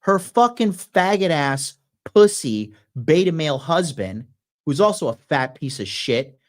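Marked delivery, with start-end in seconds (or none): none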